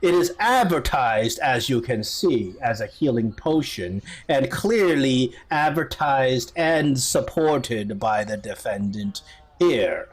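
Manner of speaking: slow, deliberate judge; stately courtroom summary, stretched words; judge summing up. Acted. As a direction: deliver a measured ruling from the bench. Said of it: "the judge kinda summarized the case".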